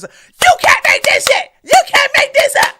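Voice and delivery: high, loud outraged shout; loud high cry of disbelief; disbelieving shout. Real, not acted. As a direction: yell it in pure disbelief. Exclaiming in disbelief.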